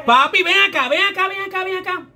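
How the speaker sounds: high loud call across the room. Real, not acted. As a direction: call someone over from afar. Calling him over from afar.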